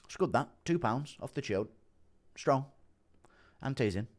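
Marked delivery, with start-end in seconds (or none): 0.0-2.7 softly spoken, terse impersonation; short approving remarks, lightly imitated and quiet; Andy impression. Streamer mimics fighter reacting to weights, lightly impersonated. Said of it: "Impression of Andy reacting to Connor's weights".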